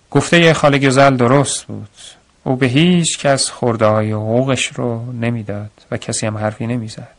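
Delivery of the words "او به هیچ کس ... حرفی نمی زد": usual speaking voice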